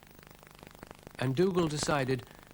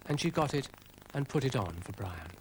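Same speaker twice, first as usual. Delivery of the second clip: ordinary delivery, nothing marked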